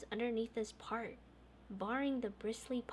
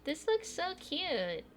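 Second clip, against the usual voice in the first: cooing; 7 semitones higher, 4 dB louder, slower than usual. Real, not acted. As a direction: coo over something adorable. Player coos at cute scene.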